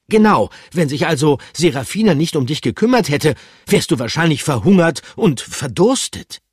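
high, loud indignant voice; indignant character voice. Acted. Pikandu, hands on hips, lectures Bertram indignantly. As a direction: scold him for his rosy memories.

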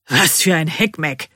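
mildly cursing workman. A narrator's reading. Workman undoing his assembly, cursing. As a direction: grumble it as a mild curse. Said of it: "flucht er".

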